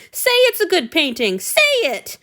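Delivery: put-on high, loud demanding boy; a cute imagined bellow; mock bellow. Hanyu imagined pinning Antony down, mock bellowing.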